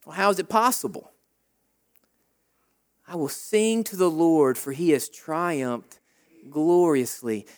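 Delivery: gentle, intimate preaching; quiet reflective preaching. Acted. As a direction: draw the room in, speak low.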